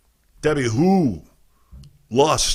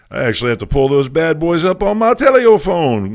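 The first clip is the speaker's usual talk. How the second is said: loud, higher, playful build on the last words; hammed-up announcement; 7 semitones higher, 8 dB louder than usual. Host hauling up the questions on the phone, hammed-up announcement. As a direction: play it big and loud, pitch climbing.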